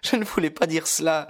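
put-on high, lively girlish voice; young girl voice. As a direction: voice Lucie bright and young. Lucie's reply. (voix de jeune fille)

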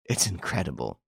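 soft, hushed murmur of disbelief; dazed, half to herself; utterly bewildered. A narrator's reading. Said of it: "she repeated, utterly bewildered".

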